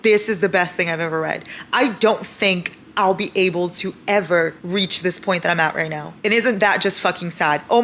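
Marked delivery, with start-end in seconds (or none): none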